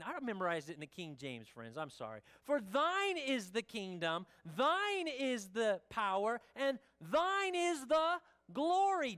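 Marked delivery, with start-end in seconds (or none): 2.4-9.2 slow, solemn doxology rising on glory; prayer recitation. Shown as a demonstration. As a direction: intone each thine slowly. Reciting doxology, King James.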